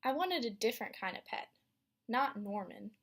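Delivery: lively read-aloud storytelling voice; story narration. A narrator's reading. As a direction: read it to a class of young kids. Teacher reads picture book aloud.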